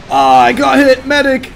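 loud, high cry for a medic; man down shout. Real, not acted. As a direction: yell for help as you're hit. Tank hit, calling for medic.

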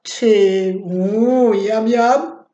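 low greedy yum yum; low greedy orc. Acted. Orc eyeing a tasty monster, low greedy yum. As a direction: growl it like a hungry orc.

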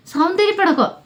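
a high, puzzled question; surprised echo. Retold or imitated. Child puzzled by father's word, surprised high echo. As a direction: toss the odd word back, baffled.

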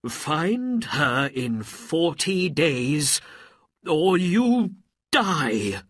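slow, high, loud threat; threatening sultan. A narrator's reading. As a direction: give a stern ultimatum, weighing every word. Sultan threatens Aladdin with death.

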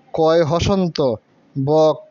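বক with the ক pressed and held longer; held consonant. Shown as a demonstration. Teacher contrasting বক with the held ক for pupils. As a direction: press and hold the ক in বক. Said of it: "একটু চাপ দিয়ে ধরে রেখেছি".

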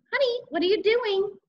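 high, singsong motherly question; mother calling. A narrator's reading. Mother checking on son through door, calling. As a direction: call through a door, pitched well up.

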